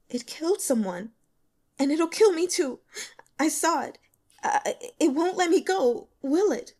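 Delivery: a scared student begging for reassurance; frightened young woman. A narrator's reading. Li Qian telling the investigator, frightened and high.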